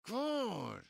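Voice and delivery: a high, loud, fed-up cry of God; exasperated. Real, not acted. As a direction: throw up your hands with the word. Exasperated sigh mid rant.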